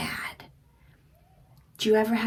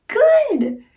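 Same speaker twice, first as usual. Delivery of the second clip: praise; 13 semitones higher, 6 dB louder than usual. Real, not acted. Praising child.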